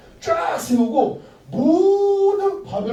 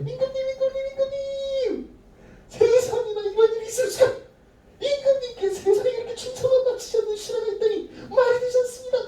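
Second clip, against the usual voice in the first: much higher, sneaky tattling courtier; whiny courtier. Acted. Scheming courtiers tattling to the king in a high whine.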